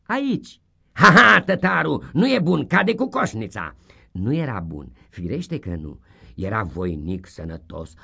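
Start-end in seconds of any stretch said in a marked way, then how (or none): 0.0-0.6 pupil answering. Acted. 0.9-3.8 high, loud, triumphant teacher crowing; loud gloating schoolmaster; gleeful schoolmaster shout. Acted. Schoolmaster judging.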